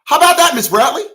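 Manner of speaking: high, loud mocking judge; hammed-up judge teasing the mother. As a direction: turn it on her with mock glee. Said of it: "the judge said".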